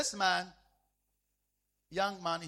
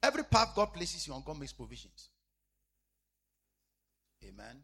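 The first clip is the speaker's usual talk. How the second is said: ordinary talk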